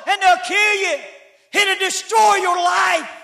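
high, loud preaching; pleading preacher's cry, loud and high; shouted preaching. Acted. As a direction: preach it at full force. Retold pleading scene, preached loud.

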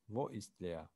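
ordinary delivery

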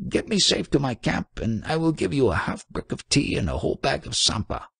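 high-pitched, tearful acted pleading; mock sobbing. A narrator's reading. Trader confessing to the monk who saved him, acted sobbing. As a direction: gabble gratefully in a high, wailing voice. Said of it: "sobbed the trader".

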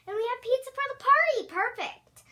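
fast, high and loud; excited. Real, not acted. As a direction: burst out with glee. Excited about the party.

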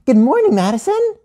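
put-on very high, bright-eyed child greeting; high-pitched cheery hello; eager greeting. A narrator's reading. Gia greets Madison with a hug.

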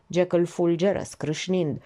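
ordinary delivery

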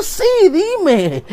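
high, thrilled answer; eager yes. Acted. Comedian answers God eagerly, high pitched. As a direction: jump at the answer.

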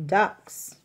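plain reading voice

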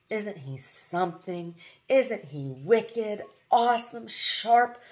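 slow, swooning admiration rising in pitch; starry-eyed girl gushing over a hero. Acted. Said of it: "she added, blushing slightly".

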